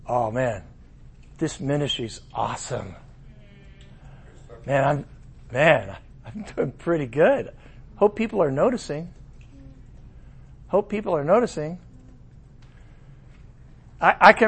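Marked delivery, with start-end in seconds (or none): 0.1-11.8 low, soft self-satisfied murmur; mock smug thoughts; smug inner voice. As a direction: murmur like a proud man. Voicing proud inner thoughts.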